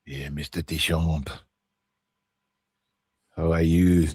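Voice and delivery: low, slow put-on Scottish voice; deep mock Scottish greeting; Scottish accent. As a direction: greet him like a Glaswegian pal. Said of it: "Don't know why I went Scottish there".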